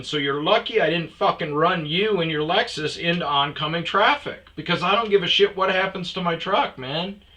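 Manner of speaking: angry confrontation. Retold or imitated. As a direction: lay down a heated warning, loudly. Retold quarrel with other driver.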